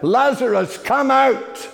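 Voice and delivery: high, loud command to the dead; loud call. Retold or imitated. Jesus calling Lazarus from the tomb, loud call. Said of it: "in a loud voice, he called out".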